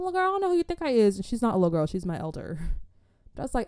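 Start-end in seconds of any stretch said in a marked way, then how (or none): 0.0-1.2 fast, high, sassy put-down; sassy comeback. Retold or imitated. Telling off an older woman sizing her up, sassy. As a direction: snap back at her with attitude.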